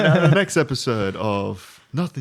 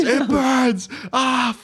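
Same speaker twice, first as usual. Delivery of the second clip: higher mock scream of pain; 10 semitones higher, much slower than usual. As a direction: yell like the fire hurts. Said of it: "all you hear is".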